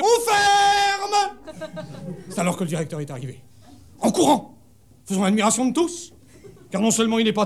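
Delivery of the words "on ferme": very high, drawn-out closing call; shouted announcement. Acted. Guide crying out closing time to visitors, long high shout. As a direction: holler it high and long across the rooms. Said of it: "j'ai crié".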